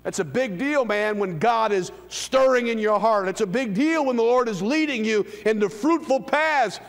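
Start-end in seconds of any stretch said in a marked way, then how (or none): none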